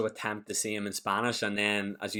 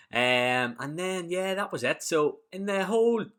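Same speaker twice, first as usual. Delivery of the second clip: ordinary talk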